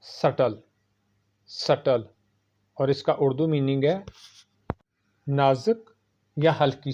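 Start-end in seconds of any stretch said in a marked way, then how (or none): 0.0-2.1 सटल with a silent b, sa-tal; सटल as sut-tal; correct pronunciation. Shown as a demonstration. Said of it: "सही है".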